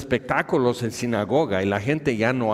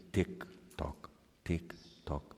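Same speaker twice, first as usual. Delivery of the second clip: soft clock tick imitation; 6 semitones lower, 12 dB quieter, slower than usual.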